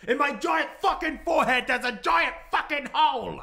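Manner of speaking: high, loud disbelieving yell; loud incredulous outburst; outraged emphasis. Acted. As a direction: hammer the giant hole in disbelief. Outraged repeat of injury.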